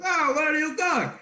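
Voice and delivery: high, loud put-on accent; imitated TV accent. Performer mimicking an accent heard on TV, high and loud. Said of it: "I was just doing that accent".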